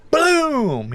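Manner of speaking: high, drawn-out "boom"; vocal sound effect of a blast; imitated blast. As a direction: mimic doors bursting open. Imitates doors blasting open.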